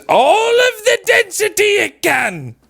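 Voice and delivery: high frustrated shouting at listeners; angry ranting. Real, not acted. Venting at people who don't get it, high angry shouting. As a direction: yell it out in real exasperation. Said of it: "Sorry, I'm getting really angry".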